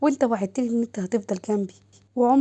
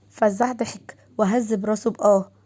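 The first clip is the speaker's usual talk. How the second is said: plain reading voice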